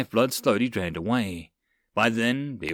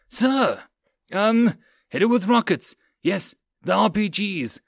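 high, flustered, raised-voice command; panicked order; 8 semitones higher, 3 dB louder than usual. Acted. Stunned lieutenant ordering rockets on troll, panicked shouting.

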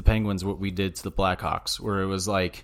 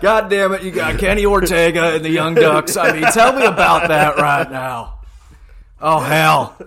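much higher, much louder put-on old man; old wrestling host; 8 semitones higher, 9 dB louder than usual. Imitating a wrestling legend grilling a guest, hammed up. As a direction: ham up a cranky old interviewer.

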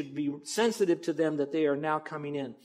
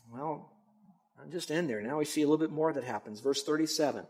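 normal talking voice

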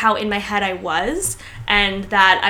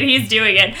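ordinary talk

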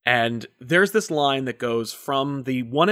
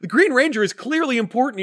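higher, emphatic mock-serious insistence; mock indignant; 10 semitones higher than usual. Defending the Green Ranger to his friend, mock-serious high insistence.

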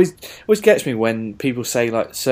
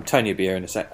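normal talking voice

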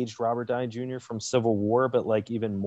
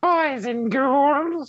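high, very slow ghoul greeting; Crypt Keeper voice; 4 dB louder, much slower than usual. Retold or imitated. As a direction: stretch it out like a creepy host. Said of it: "I keep saying boys".

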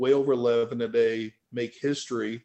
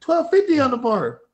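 raised, louder repeat; insistent calling out; 11 semitones higher, 6 dB louder than usual. Retold or imitated. Man repeating the weight to a deafened lifter, calling it out. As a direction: call it over to someone who can't hear.

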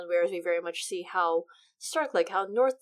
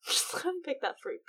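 higher, giggling repeat of the joke; laughing; 8 semitones higher, 5 dB quieter than usual. Real, not acted. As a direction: laugh through it, amused by your own pun.

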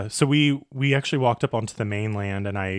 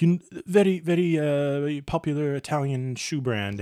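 Italian shopkeeper; 5 semitones higher than usual. Retold or imitated. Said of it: "he was like".

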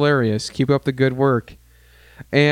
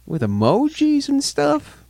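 high, slow mocking repeat; 12 semitones higher, slower than usual. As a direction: squeak it out, mocking the phrase. Mocking repeat of own phrase.

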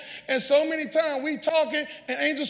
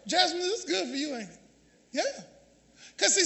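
usual speaking voice

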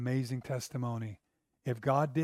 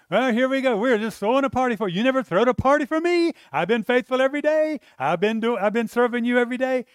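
much higher and louder, bitter fast complaint; jealous older brother; 10 semitones higher, 11 dB louder, faster than usual. Retold or imitated. Older son protesting to his father, loud and bitter. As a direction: throw the complaint at dad like a wounded son. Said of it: "we are throwing a party for him".

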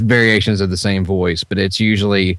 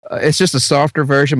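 normal talking voice

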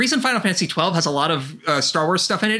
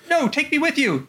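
whiny high plea; 9 semitones higher than usual. Retold or imitated. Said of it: "Ventus ... is just like".

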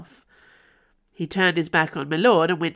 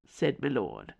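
plain reading voice

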